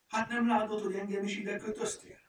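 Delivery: high, loud, indignant protest; angry shout. A narrator's reading. Furmány tied up by Lupi, shouting angrily. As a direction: scold loudly at a high pitch. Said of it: "kiabált mérgesen a furmány".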